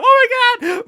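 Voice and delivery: high shrieking Oh my God; hammed-up panicked scream; mock scream. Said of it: "My aunt starts screaming".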